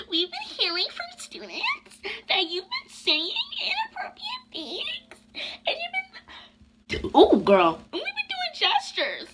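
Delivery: squeaky high put-on voice of a prissy teacher; mocking the teacher. Mocking the teacher who accused her, squeaky and high. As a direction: whine it out like a fake-sweet teacher. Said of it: "this is how she came at me".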